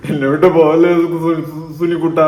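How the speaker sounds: slow, stuttering imitation of begging; mock tearful pleading. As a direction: plead in fake sobs, tripping over the name. Mimics the crying clip just played. (കളിയാക്കി കരഞ്ഞ് യാചിക്കുന്നു)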